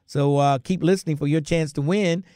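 ordinary talk, nothing marked

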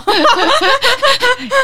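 loud squealing laugh; high laugh burst. Real, not acted. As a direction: burst out in a shrill giggle.

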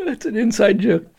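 high, breathless tearful laughter; cracking up to tears; laughing hard. Real, not acted. Laughing until crying.

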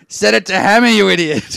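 loud, high, exasperated shout imitated; neighbour's yell. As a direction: yell it like a fed-up neighbour through a wall. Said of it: "My neighbour yells out".

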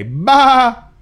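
high loud playful baa; sheep bleat. Shown as a demonstration. Host shows the sheep sound.

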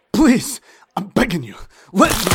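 high, loud begging; desperate pleading. Acted. Captured courier begging the detectives to let him go. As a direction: beg them, terrified.

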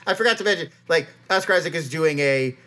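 ordinary delivery

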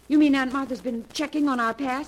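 high, loud indignant question; alarmed disbelief. Acted. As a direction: burst out, shocked at being checked up on. Learns aunt investigated their past.

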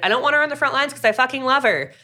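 Jared blurting that he loves her; blurted confession. Retold or imitated. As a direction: blurt it out before you can stop. Jared blurting his feelings.

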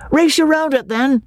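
high, bright call to race; a boy calling a race; excited cry. A narrator's reading. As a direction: shout it out playfully. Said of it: "cried Freddy".

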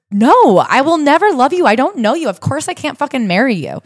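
high, exasperated retold rejection; emphatic refusal. Woman rejecting the suitor who showed up, emphatic refusal. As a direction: act out turning him down hard, pitch way up.

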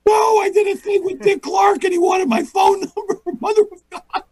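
very high, squealed mock outburst; frantic husband. Husband tells wife it was really Dick Clark, frantic squeal. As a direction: wail it in comic despair.

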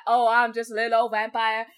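imitating a Southern vampire accent; mock Southern drawl. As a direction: tease Bill Compton's folksy Southern accent. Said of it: "Bill Compton strolls in just like".

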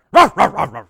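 loud hammy grunt, like a dog; Tim Taylor grunt. Acted. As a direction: bark out a gruff grunt. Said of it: "Let me try it".

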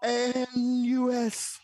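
spelling out. Real, not acted. Guest spelling a name to be looked up, very slow letters. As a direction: spell it out one drawn-out letter at a time. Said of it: "Can you spell it?".

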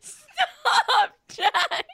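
high-pitched laughing protest; real helpless laughter. Real, not acted. Host cracks up at Jack's impression, laughing plea. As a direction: beg him to stop through laughter.

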